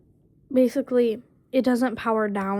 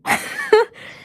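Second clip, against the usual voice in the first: high giggle; 13 semitones higher, 5 dB louder than usual. Real, not acted. Laughing after mocking herself.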